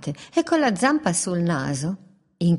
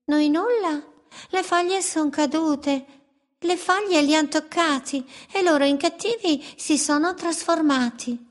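higher, sly bird voice; mangy birds, mocking; 9 semitones higher than usual. Mangy birds greet the wolf, higher mocking voice. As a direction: tease the wolf from the branch.

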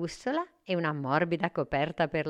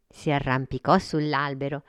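usual speaking voice

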